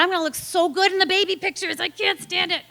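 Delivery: high, gushing delight; giddy. Retold or imitated. Admiring herself in the mirror, giddy and high. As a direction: squeal it to yourself.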